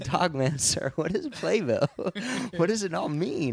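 high, loud, slow bewildered newcomer voice; naive immigrant voice. Retold or imitated. Mocking his younger self to a friend, hammed-up bewildered voice. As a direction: play the lost foreigner asking what Playbill is, hammed-up. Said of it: "I said".

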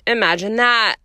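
deadpan sarcasm; dryly. A narrator's reading. McGonagall scoffing at the seer, dry. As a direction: say it bone dry. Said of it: "said Professor McGonagall dryly".

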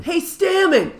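loud boss shout across the newsroom; yelling across the room. Retold or imitated. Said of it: "yelling across the room".

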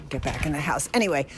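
normal talking voice

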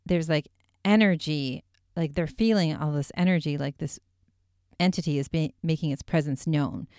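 normal talking voice

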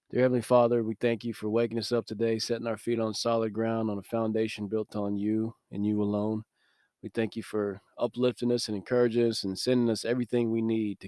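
soft, reverent prayer voice. Acted. Podcast host praying before his message, soft and reverent. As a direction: quietly lead the listeners in prayer.

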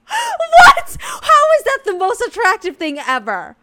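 high, loud, laughing disbelief; real giddy outburst. Real, not acted. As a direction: burst out in thrilled shock. Gushing over the quote just read.

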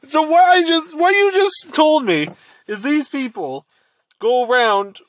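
high-pitched disbelief, easing down toward the end; incredulous recap. Real, not acted. Recaps the absurd answer, disbelieving.

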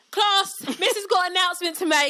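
high, loud calling-out voice; imitated class announcement. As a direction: summon the whole classroom's attention as a pupil. Student announcing to the whole class.

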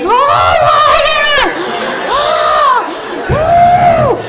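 high, stretched-out hysterical whooping; hammed-up high hoots and wails; mock cracking up. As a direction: imitate someone helpless with laughter. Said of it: "This bitch is cracking the fuck up".

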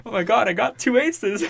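high, loud giddy gloat; excited poker player. Pretend. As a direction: blurt out a great hand. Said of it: "you shouldn't play poker".

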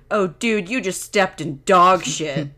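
loud mock dad exclamation, slowing to the end; dad calling out. Dad calls out from the kitchen to his son, loud exclamation. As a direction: call it out like a dad from another room. Dad's shouted quote from the kitchen.

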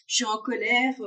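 a hammed-up little boy declaring his anger; child's indignant little voice, played for laughs; imitating the little son. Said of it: "Il dit toujours".

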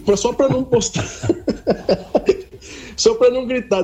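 giggling while explaining; laughing. Real, not acted. Rindo ao contar o trote. (rindo enquanto explica)

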